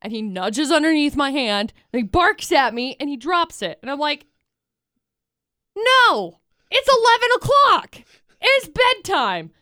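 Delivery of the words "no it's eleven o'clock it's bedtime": high, firm no to the dog, slowing on bedtime; mock scolding. Telling the dog it's eleven o'clock, mock scolding. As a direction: put the dog to bed firmly.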